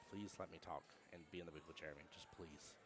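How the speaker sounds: soft, hushed begging; pleading. Retold or imitated. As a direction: beg under his breath. Retold pleading prayer.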